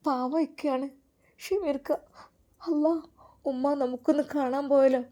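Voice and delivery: high, soft voice of a stunned woman; acted distress at bad news; shocked Seenath. A narrator's reading. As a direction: plead in tearful shock, haltingly. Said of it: "Zeenath ഒരു നിമിഷം ഞെട്ടിപ്പോയി".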